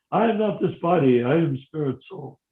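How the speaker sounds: ordinary talk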